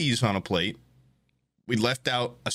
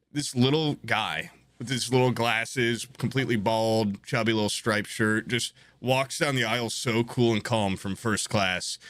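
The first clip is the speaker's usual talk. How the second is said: normal talking voice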